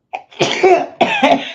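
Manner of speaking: high sharp cough sneeze. Real, not acted. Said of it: "It's a cough sneeze".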